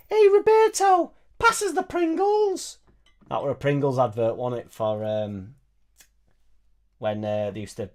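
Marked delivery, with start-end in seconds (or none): 0.1-2.8 put-on very high, drawn-out falsetto like an elderly woman; badly faked Liverpudlian in a squeaky-high slow voice; bad Steven Gerrard. Recalling a Pringles advert for viewers, hopelessly high-pitched footballer impression. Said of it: "Steven Gerrard opens up and goes".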